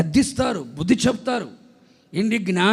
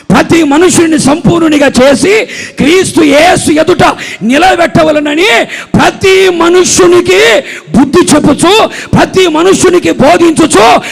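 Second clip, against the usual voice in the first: high, very loud pulpit shout; full-force preaching; 8 semitones higher, 16 dB louder, slower than usual. Real, not acted. Preacher reciting scripture to congregation, thundering delivery. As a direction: proclaim each phrase with mounting force.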